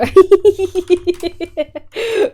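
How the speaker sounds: loud high burst of laughter. Real, not acted. Streamer cracking up at a chat message, loud high laughing. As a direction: crack up loudly.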